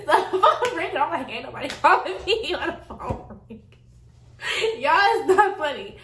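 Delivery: giggling through the words; high, laughing retelling. Real, not acted. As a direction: laugh while you talk. Laughing while telling the story.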